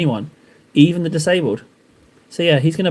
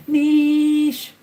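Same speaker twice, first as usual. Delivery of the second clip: high mock niche; 12 semitones higher than usual. Playful high repeat.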